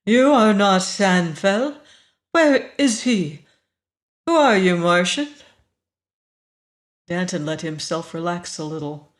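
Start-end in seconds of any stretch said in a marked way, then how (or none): 0.0-5.3 imperious, wary. A narrator's reading. Woman questions the stranger in Sanfel's place, imperious and wary. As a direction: challenge an intruder from a position of power. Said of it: "It was imperious and a little wary".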